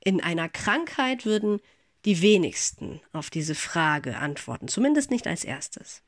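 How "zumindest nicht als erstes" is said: normal talking voice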